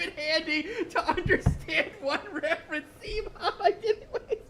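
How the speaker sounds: high, loud laughing, voice cracking up; laughing hard. Real, not acted. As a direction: squeal it out through laughter. Laughing joke to mom.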